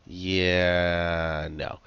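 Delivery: long drawn-out yeah, then no; lightly imitated stubborn toddler; mock toddler refusal. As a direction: drag out the yeah like a balking toddler. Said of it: "any good toddler would do".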